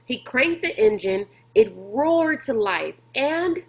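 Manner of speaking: ordinary delivery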